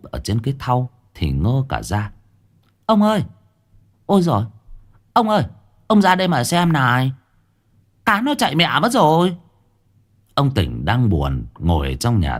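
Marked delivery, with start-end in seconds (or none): 2.9-9.4 high, urgent wife calling out; alarmed wife calling. Acted. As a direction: cry out for the husband to come look. (bà vợ gọi chồng hốt hoảng, giọng cao)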